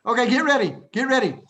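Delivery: loud, high call to attention; rallying the class. Real, not acted. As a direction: call it out to the whole room.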